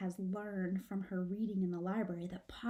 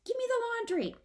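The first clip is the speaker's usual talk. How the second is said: high frantic demand; urgent; 13 semitones higher, faster than usual. Acted. Izzy snatching laundry from mom, high and frantic. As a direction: grab for it desperately.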